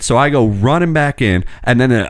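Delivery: normal talking voice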